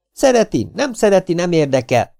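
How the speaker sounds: high, loud woman's shout. Acted. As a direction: yell back at the warlord, refusing to budge. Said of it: "kiabált Hollóné". (magas, hangos asszonykiabálás)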